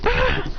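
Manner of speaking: short, high imitated yelp; mock pain cry. Imitated cry as hand comes off.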